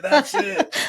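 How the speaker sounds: high, loud excited cry. Retold or imitated. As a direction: shout it like you found the answer. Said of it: "I know Christine was like".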